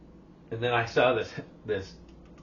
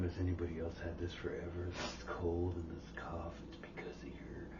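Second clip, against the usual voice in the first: low, soft, half-whispered sick voice; sick TikTok voice; 5 semitones lower, 15 dB quieter than usual. Retold or imitated. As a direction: murmur it like a sick influencer. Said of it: "like that TikTok going around".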